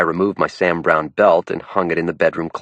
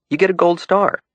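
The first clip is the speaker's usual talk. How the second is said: teasing praise; 11 semitones higher than usual. A narrator's reading.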